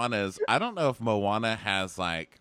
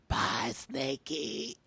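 high, slow, drawn-out mocking farewell; hammed-up taunting bye; taunting singsong; 13 semitones higher, 4 dB quieter, much slower than usual. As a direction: sing the goodbye to needle him.

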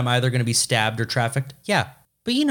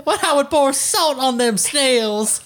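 hammed-up Southern accent; 14 semitones higher than usual.

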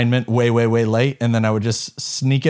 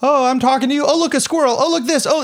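mock distracted; 11 semitones higher, faster than usual. Mocking scattered ADD talk.

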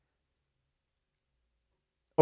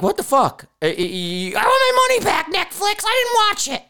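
whiny complaining customer. Retold or imitated. Forum owner mocking a customer who whines to Netflix, high crying whine. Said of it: "you're like".

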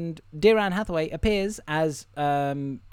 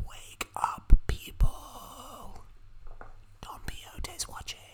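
voiceless, eerie whisper far from the mic; ghostly conspiracy whisper; creepy whisper; 9 semitones higher, 4 dB quieter, slower than usual. Acted. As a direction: whisper it like a warning from the dark. Spooky sign-off whisper.

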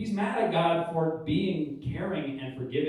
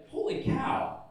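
mock amazement; 9 semitones higher, slower than usual. Awed at what God does.

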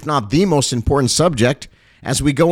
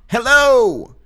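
high ringing call from a rooftop; calling down from the rooftop, much higher; rooftop alarm call; 8 semitones higher, slower than usual. Pretend. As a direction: shout it out like a watchman. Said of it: "just picture me right now on the rooftop".

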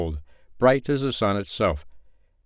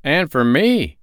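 high, loud quick agreement; traveler's voice; 7 semitones higher, 6 dB louder, slower than usual. Acted. One traveler agrees with the man, high and loud. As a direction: agree at once. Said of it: "said one of the travelers".

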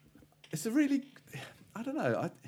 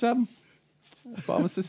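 ordinary talk, nothing marked

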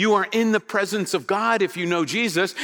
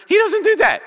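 much higher, louder exclamation; emphatic rebuttal; 6 dB louder than usual. Real, not acted. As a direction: knock the idea down in a high, raised voice. Exclaimed rebuttal of acted theology answer.

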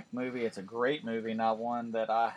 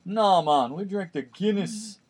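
loud, higher, slow mock Jamaican resort guy; hammed-up Jamaican accent; 9 semitones higher, 9 dB louder, slower than usual. Retelling the resort guy's Guinness advice, loud mock Jamaican. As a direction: ham up a Jamaican accent, stretching the words. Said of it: "that's a terrible Jamaican accent".

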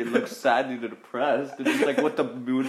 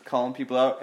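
ordinary talk, nothing marked

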